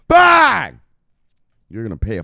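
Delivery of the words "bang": very high, very loud "bang"; explosive shout. Real, not acted. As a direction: fire it off like a cannon. Punctuates his hype rant.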